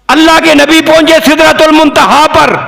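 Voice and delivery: high, sustained pulpit call; preaching declamation. Real, not acted. Preacher telling worshippers of the Prophet's ascension, declaiming. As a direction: lift the whole line high and hold it.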